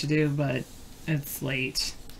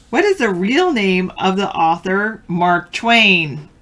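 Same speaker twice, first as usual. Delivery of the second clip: trivia host read; 3 semitones higher, 8 dB louder than usual. Acted. Reads out trivia question.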